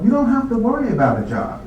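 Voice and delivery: usual speaking voice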